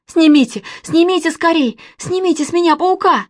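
loud, high frantic plea; urgent squealing demand; panicking. A narrator's reading. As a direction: beg for rescue from the spider. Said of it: "вдруг всполошился Костя".

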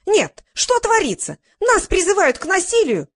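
high, loud shout of protest; outraged yelling. A narrator's reading. Spectator denouncing the call to violence, yelling. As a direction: yell it out to rouse the hall. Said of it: "заорала".

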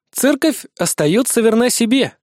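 ordinary delivery

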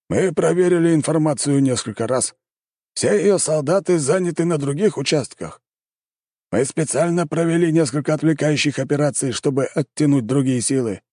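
high, insistent commander defending the intelligence; exclaiming. A narrator's reading. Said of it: "воскликнул командующий". (с восклицанием, настойчиво)

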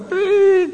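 high whistled pi; whistle imitation.

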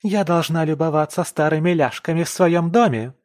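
high, loud indignant shout; screeching. Acted. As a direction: keep screeching. Nika keeps screeching.

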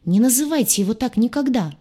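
high, loud burst of indignation; crying out. A narrator's reading. Patient defends the captain to the doctor, crying out. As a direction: explode in outraged defence of someone. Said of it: "вскрикнула".

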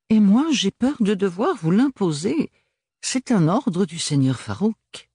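high, lilting, sure of himself; pleasantly forcing the issue; insistent Archibald. Acted. Archibald impose un ordre.

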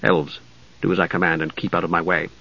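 low, hushed order; commanding knight. Acted. Knight warns the mage about elves, low quiet command. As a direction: give the order quickly under your breath.

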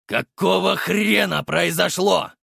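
slow, menacing growl of a boss; angry demand. A narrator's reading. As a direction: demand it slowly, seething. Furious gang leader demands.

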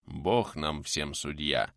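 slow, low and sorrowful; mournful. Acted. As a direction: grieve quietly over the words. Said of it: "скорбно отвечал купец".

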